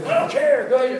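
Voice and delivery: loud, high, dismissive outburst; acted impatient yell; insistent mock shout. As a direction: imitate a mogul loudly waving away every objection. Acts out insistent mogul brushing off objections.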